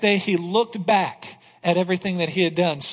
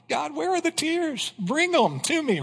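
mock tearful plea; 8 semitones higher than usual. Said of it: "I need to cry out in tears before God".

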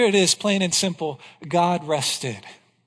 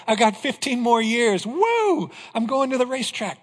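higher, whooping and fast, like someone who just won extra years; mock excited celebration; 4 semitones higher than usual. Pastor mimicking a listener thrilled by fifteen more years, whooping. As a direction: play a giddy guy heading to the racetrack.

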